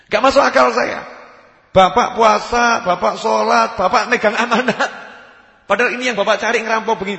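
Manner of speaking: high, loud protest; incredulous challenge. Acted. Asker challenges the robber who prays and fasts, loud incredulous protest.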